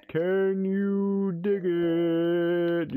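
very slow, high, loud stretched line; playfully stretching out the catchphrase; drawn-out catchphrase. Pretend. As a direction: stretch it out like a showman.

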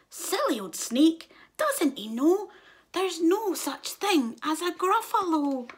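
high teasing mouse; mouse mocking. A narrator's reading. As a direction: laugh at the silly snake. Mouse mocks snake.

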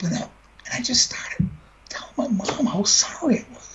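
barely voiced, breaking with sorrow; a grieving son's broken whisper; choked whisper. Real, not acted. Grief, speaking to dead mother.

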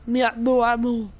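mock the friend like a caveman. Mocking teammate in caveman grammar.

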